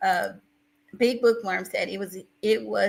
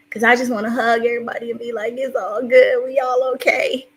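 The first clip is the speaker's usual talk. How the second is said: louder, tearful reassurance; fighting back tears; 3 semitones higher, 6 dB louder than usual. Real, not acted. Streamer wanting to hug everybody, tearful. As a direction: comfort everyone while close to crying. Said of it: "I'm trying not to cry".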